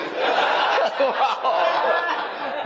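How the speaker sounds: long high laugh falling away. Real, not acted. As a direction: laugh at the idea of a saints' group chat. Preacher laughing at own joke.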